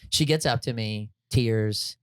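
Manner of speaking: usual speaking voice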